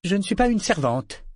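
a touch of proud dignity; proud remark. A narrator's reading. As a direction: set him straight with dignity. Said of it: "fit remarquer Série".

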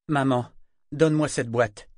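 loud, commanding demand; peremptory. A narrator's reading. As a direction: order it, no room for refusal. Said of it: "d'un ton péremptoire".

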